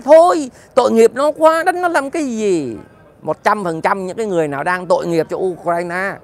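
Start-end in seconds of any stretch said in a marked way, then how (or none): 0.0-2.8 mocking a whiny bleeding heart, much higher and louder; hammed-up plea to stop the beating; mock pity. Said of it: "chúng mày nói tội nghiệp quá".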